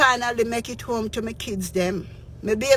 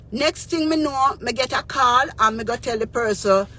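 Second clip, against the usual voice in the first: ordinary talk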